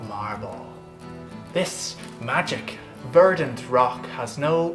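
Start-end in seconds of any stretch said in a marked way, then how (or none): none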